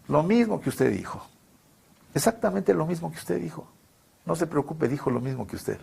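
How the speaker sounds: soft, understated mock reassurance; respuesta tranquilizadora imitada. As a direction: quote a quiet reply to a worried parent. Responde al padre preocupado. (respuesta en voz baja, tranquilizando)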